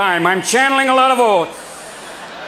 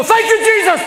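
mock fan praise; 11 semitones higher than usual. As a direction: shout it up to the sky like a revival. Fan praising Bulls win.

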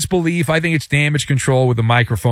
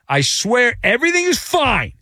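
mock insistence; 7 semitones higher than usual. Acting out the league's insistence.

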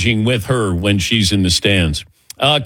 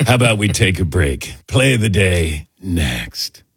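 mock breathy voice; 5 semitones lower than usual. Host mockingly does the breathy voice for the next segment. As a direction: lightly purr the segment intro. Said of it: "in that breathy voice".

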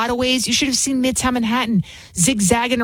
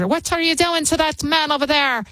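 higher put-on Irish accent; 6 semitones higher than usual. Host imitating a Belfast woman scolding, Irish accent. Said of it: "I'm trying to do my Irish".

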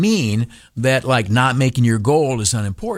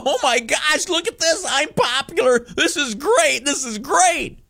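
much higher, giddy self-delight; mock gushing; 14 semitones higher than usual. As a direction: gush like a fan of yourself. Gushing over shared post.

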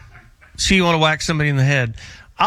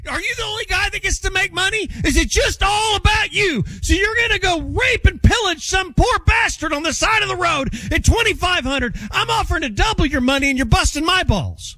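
high-pitched, exasperated haggling rant; worked-up, high-pitched tirade; yelling; 12 semitones higher than usual. Real, not acted. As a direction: yell indignantly at a stubborn seller. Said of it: "there's no yelling that goes on".

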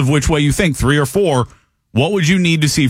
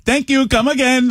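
much higher, quick mock Apu line; playful Simpsons shopkeeper imitation; Apu impression; 12 semitones higher than usual. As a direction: show him how the Apu line should sound. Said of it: "You didn't even do it".

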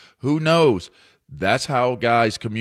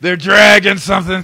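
mock shout; 7 semitones higher, 10 dB louder than usual.

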